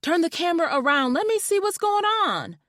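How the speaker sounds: high, fast excited call; hollering. A narrator's reading. Friend hollering through the phone to see the house. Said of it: "still hollering".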